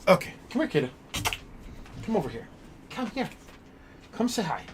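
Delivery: high soft coaxing; coaxing pet talk. Real, not acted. Streamer coaxes puppy Kata to come say hi, high soft pet talk. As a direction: call the puppy in high and gentle.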